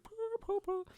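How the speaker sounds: high, soft cat purr; dainty purring noise; mock purring. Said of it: "And he's like".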